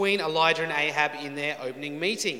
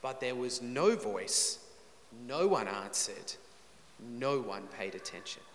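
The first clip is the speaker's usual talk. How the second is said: slow, hushed stress on each "no"; emphatic negatives; 5 semitones lower, 7 dB quieter than usual. Acted. Narrator stressing three no's in the silence, slow and hushed. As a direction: weigh every "no", sinking lower and softer. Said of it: "but three no's".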